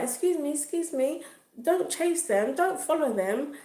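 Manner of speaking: high-pitched urgent call after someone; high, insistent mock plea; retold urgent warning. Retold calling after victim to stop.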